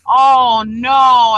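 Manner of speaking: loud, drawn-out "oh no" of dawning horror; dismayed realisation. Retold or imitated. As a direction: act out someone realising their blunder. Retells friend realising her mistake.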